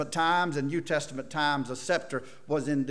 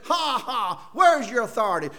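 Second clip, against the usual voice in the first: jeering taunt, higher and louder; mocking soldier; 7 semitones higher, 6 dB louder than usual. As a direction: sneer like a tormentor. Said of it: "They took the reed and said".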